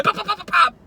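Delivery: high mock pops of a gun; silly imitated gunfire; gunshot noises. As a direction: fire off pretend shots. Mimicking gunshots.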